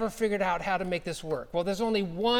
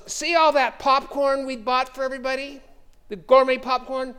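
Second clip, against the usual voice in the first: higher, louder, eager hostess pushing popcorn; eager offer; 5 semitones higher, 4 dB louder than usual. Retold or imitated. Old lady urging the pastor to take popcorn, eager and raised. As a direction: sell the popcorn like a delighted hostess.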